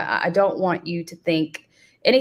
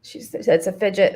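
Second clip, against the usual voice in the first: usual speaking voice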